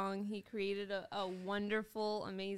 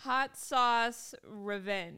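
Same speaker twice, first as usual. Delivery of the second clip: slow reading; much slower than usual. Real, not acted. Reading label name slowly.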